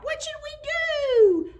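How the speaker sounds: put-on very high piping children's cry; children's voices. A narrator's reading. Narrator voices the worried children, high and piping. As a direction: squeak it out like little kids.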